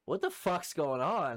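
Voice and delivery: high, loud, slow mock alarm; retold bewilderment. Man being swung at by the girls, acted loud bewilderment. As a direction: replay your baffled protest.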